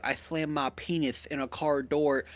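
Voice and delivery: penis said as "penith", lisped s. Retold or imitated. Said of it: "with a lisp all the time".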